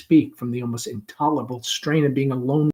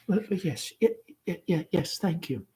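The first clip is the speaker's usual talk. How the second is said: soft stammering, higher; shy girl too nervous to speak; thin, breathless; 5 semitones higher, 6 dB quieter than usual. Acted. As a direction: stammer it out as if winded. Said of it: "Laura's voice is thin and breathless".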